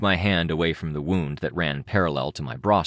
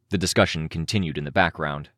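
plain reading voice

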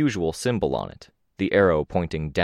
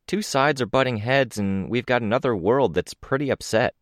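a lighter, higher voice for Crystal; casual young woman, lightly voiced; Crystal's voice; 6 semitones higher than usual. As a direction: lift the pitch a little for the friend.

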